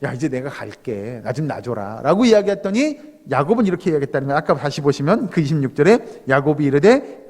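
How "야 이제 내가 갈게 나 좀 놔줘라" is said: slow, low, soft voice of God; God speaking casually. Acted. God telling Jacob to let go, low and soft. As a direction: play God gently asking leave, pitch dropped. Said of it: "오늘 야곱은 하나님께서".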